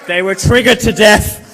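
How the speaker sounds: gleeful punchline shout. Real, not acted. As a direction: belt out the punchline to the hall.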